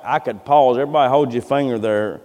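ordinary talk, nothing marked

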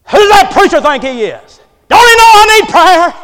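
loud, climbing mock-outraged shout; acted indignant outburst. Acts out an indignant churchgoer's complaint.

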